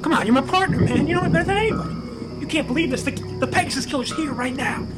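high, agitated appeal to a partner; desperate pleading. Acted. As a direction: beg your partner to believe you. Begs his partner to believe.

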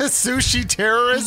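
slow, high disbelieving question; incredulous. Real, not acted. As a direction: say it like you can't believe it. Incredulous reaction to the topic.

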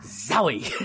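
ordinary delivery

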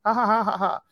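high-pitched mock laugh; imitated laughter. Girl teasing over a romance novel, high mock laughter. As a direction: put on a high "ha ha ha". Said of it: "starts laughing".

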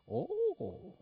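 high, booming guru "oh"; preacher impersonation. Acted. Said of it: "this is how he talked".

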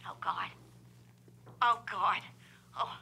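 breathless scared whisper; frightened whisper. Acted. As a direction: whisper it in dread.

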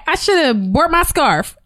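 high, slow, pointed code line; code phrase. Retold or imitated. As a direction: drop the secret signal with mock innocence. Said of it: "Cece says".

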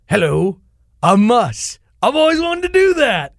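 mock a douchey radio host hyping himself; cheesy radio DJ. Mocking cheesy radio host intro.

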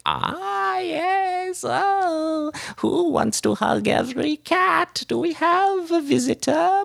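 very high, crooning witch voice; cackling old hag. Acted. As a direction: sweet-talk the cat like a creepy old hag. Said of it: "the woman next to it pets it and is like".

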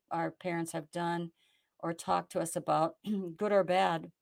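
ordinary talk

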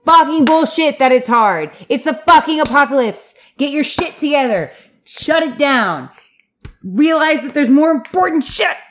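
worked-up, emphatic tirade; fed-up ranting in hard bursts; exasperated rant. Real, not acted. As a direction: let loose at people wasting time. Angry rant at bickering characters.

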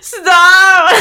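laughing flustered stop. Retold or imitated. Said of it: "I'm like".

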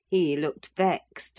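plain reading voice

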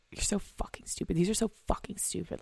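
harsh whisper at her own reflection; very soft whispered self-disgust; whispered self-scolding. Real, not acted. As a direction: hiss insults at herself in the mirror. Scolding herself in mirror.

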